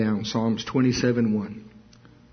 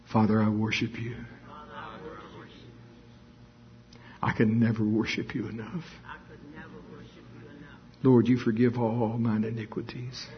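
prayer. Acted. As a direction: murmur it to the Father. Quiet worship prayer.

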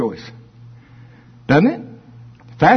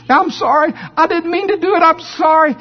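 put-on pleading child; 13 semitones higher than usual. Repentant child voice.

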